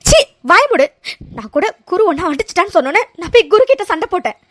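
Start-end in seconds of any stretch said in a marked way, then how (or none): 0.1-0.9 loud high angry snap; furious. Acted. Aara to Aravind, furious shout. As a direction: cut him off in fury.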